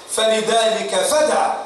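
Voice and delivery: "فدع" drawn out slowly, stopping on the final ع; slow down and stop dead on "فدع"; slow pause at the end. Shown as a demonstration. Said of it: "هكذا نقف". ("فدع" ببطء مع الوقف على العين)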